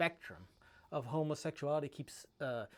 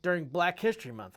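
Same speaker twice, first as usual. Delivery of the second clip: lightly voiced complaining dad; 3 semitones higher, 7 dB louder than usual. Son retelling dad's birthday complaint, mildly raised mock griping. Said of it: "my dad would be like".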